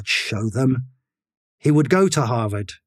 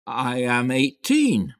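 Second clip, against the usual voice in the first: unsure repeat; slower than usual. A narrator's reading. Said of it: "unsure, and repeat".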